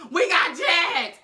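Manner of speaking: loud, high, slow shout through laughter; mocking the robbed family with a loud laughing shout. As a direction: cackle out the news. Mimicking the mother, laughing.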